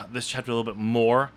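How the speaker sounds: ordinary delivery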